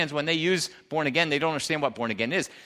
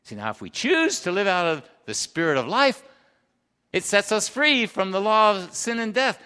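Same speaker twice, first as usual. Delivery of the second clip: ordinary delivery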